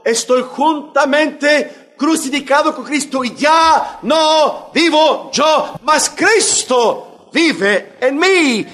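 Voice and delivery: high, loud and slow on no vivo yo; emphatic preaching. Acted. Preacher to congregation quoting Paul, slow emphatic preaching. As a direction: preach each word of Paul's line out.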